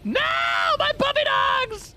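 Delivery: high, loud, slow panicked wail; mock panicked wail. Real, not acted. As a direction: wail for the lost puppies, hammed-up. Lost her puppies, wailing.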